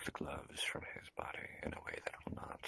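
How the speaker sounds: whisper. Acted. Whispered request.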